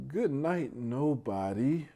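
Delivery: ordinary delivery, nothing marked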